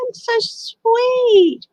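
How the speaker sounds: very high, slow, drawn-out gush; touched. Real, not acted. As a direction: melt at a touching story, stretching every word. Moved by a viewer's story.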